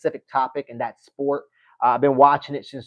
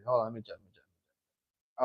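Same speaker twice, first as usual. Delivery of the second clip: muttered to self; 4 semitones lower, 12 dB quieter than usual. Real, not acted. Searching chat, talking to himself.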